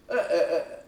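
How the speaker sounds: short effortful grunts; straining grunts. Retold or imitated. Acting out straining on the toilet.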